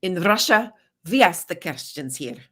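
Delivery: put-on Russian accent, menacing; Russian accent, in Russia we ask; heavy Russian accent menace. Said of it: "talk about the Russian Federation".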